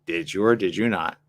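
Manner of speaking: ordinary delivery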